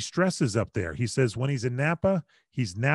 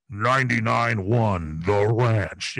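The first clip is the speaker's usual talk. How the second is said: very slow, louder radio announcer read; radio station ID; 2 semitones lower, 6 dB louder, much slower than usual. Retold or imitated. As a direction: stretch out the station name like a DJ. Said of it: "the guy go".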